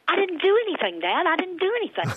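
put-on high, pleading little-girl voice; childish protest. Daughter pleading with her dad, high childish protest. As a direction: protest your innocence like a scared kid.

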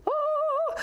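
long high "oh" of wonder; high wondering "ooh"; awed "oh". Retold or imitated. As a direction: let out a high, drawn-out oh. Awed reaction on entering meeting.

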